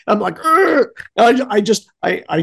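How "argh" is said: high acted "argh"; mock groan of frustration; frustrated growl. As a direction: growl in exasperation, pitched up. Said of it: "like, I'm frustrated".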